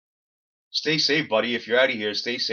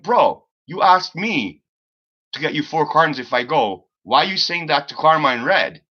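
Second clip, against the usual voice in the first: retold exasperated reply; 5 semitones higher, 6 dB louder than usual. Retold own angry reply to Hippie.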